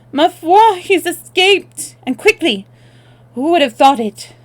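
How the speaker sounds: put-on high loud French girl's cry; clear and high. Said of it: "Annette's voice, clear and high".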